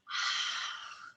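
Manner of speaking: long unvoiced H exhale, like fogging a mirror; breathy H exhale onto a mirror; whispered H breath. Shown as a demonstration. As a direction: breathe out a soft whispered haaa. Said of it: "like a".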